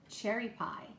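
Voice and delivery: normal talking voice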